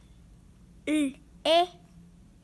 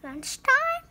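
high, rising surprise; surprised question; 6 semitones higher than usual. Acted. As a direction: catch on with sudden hope. Gerald surprised by lunch.

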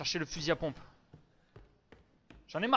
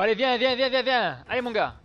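higher, louder come-on to the monster; taunting call; 8 semitones higher, 8 dB louder than usual. Real, not acted. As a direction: call it in, goading.

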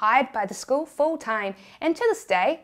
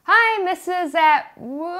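sing-song class greeting; 6 semitones higher, 5 dB louder, slower than usual. Retold or imitated.

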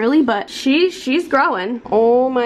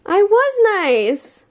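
whiny protest; 9 semitones higher, 4 dB louder, much slower than usual. Real, not acted. Defending herself to husband.

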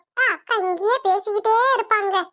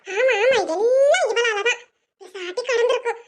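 usual speaking voice

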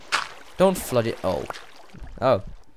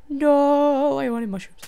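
much higher, whining no; whiny complaint; 6 dB louder, slower than usual. Real, not acted. Disappointed whine at mushrooms.

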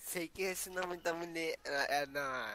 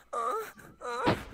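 mock whiny mother voice; imitating mom; 6 semitones higher, 3 dB louder than usual. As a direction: lightly mimic a friend's mom. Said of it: "é assim, ó". (imitação leve de mãe chorona)

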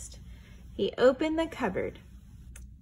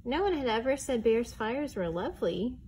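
ordinary delivery

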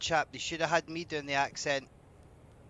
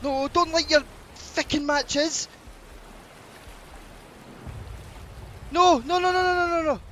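high, loud panicked pleading; frantic; 14 semitones higher, 6 dB louder than usual. Real, not acted. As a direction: beg them to stop, frantically. Panics over matches being lit.

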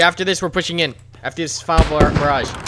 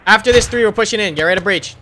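shouting over gunfire; 6 semitones higher, 3 dB louder than usual. Real, not acted. Leader commands the push.

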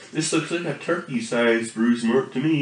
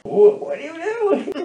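laughing; 13 semitones higher, 4 dB louder than usual. Real, not acted. High laughing question.

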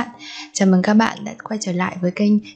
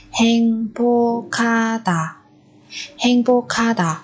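slowed for learners. Shown as a demonstration. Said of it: "Ở đây chúng ta lại có biến âm".

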